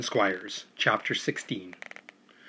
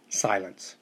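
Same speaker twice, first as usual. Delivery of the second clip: ordinary delivery, nothing marked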